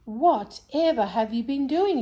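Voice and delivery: shocked at the state of them; astonished. Acted. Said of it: "Mother was astonished".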